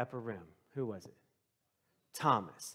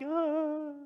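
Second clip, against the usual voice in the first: frightened; 13 semitones higher, 5 dB louder than usual. Retold or imitated. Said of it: "they're like".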